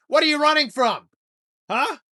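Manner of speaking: loud, high taunting shout; mock yell. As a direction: yell it at them, mocking. Ranting at pi memorizers.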